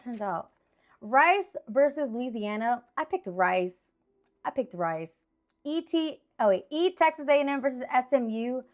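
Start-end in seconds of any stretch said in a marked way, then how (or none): none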